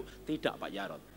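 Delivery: low, soft wounded wife; low, soft wife confessing; wife's voice. Acted. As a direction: answer quietly, sure of it. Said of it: "suami saya selingkuh".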